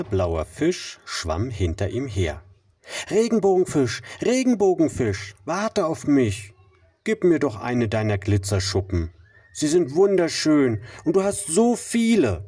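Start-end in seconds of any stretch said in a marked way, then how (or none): 3.1-6.5 high, pleading little fish; small fish voice. Acted. Little fish calls after him. 7.0-12.4 small fish voice. Acted. Little fish begs.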